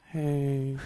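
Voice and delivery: low, dragging "Hey"; sad voicemail voice. Retold or imitated. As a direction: sound like Eeyore. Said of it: "her sad voicemail".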